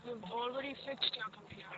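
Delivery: You've already fixed your computer said very softly, barely audible; quiet. Real, not acted. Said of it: "Talk up, I can't hear you".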